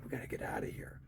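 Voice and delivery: hushed panicky whisper to a friend; urgent whisper. Retold or imitated. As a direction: whisper it like you're sneaking away. Fleeing a riot, whispered reaction.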